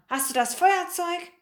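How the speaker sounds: high, irritated demand; growled question. A narrator's reading. Other man asks Maxe about the lighter, growled question. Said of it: "knurrte der andere Mann".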